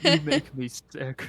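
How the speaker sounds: laughing mock disgust. Mock insult while laughing.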